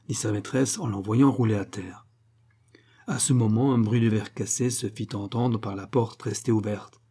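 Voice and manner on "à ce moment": ordinary delivery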